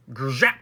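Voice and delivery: loud buzzing zap flicked upward; arrow zap. A narrator's reading. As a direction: imitate an arrow striking with a buzz. Imitates the arrow striking.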